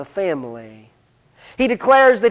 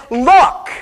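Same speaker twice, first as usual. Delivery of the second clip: loud, higher, disrespectful "Look"; curt indignant son; 5 semitones higher, 6 dB louder than usual. Acted. Son opens his speech to his father without a title, indignant. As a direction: throw out "Look" like an affronted son. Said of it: "and he just says".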